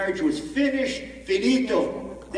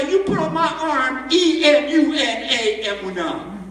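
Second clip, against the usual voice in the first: firm tattoo order; 5 semitones higher, 6 dB louder than usual. Retold or imitated.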